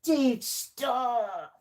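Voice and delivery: high, drawn-out mock protest; playful high-pitched "stop it" voice; put-on accent voice. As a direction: object in a silly high put-on voice. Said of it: "my very different accents I do".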